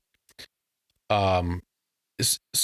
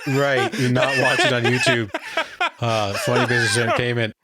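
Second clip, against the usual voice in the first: laughing. Real, not acted. Still laughing during plug.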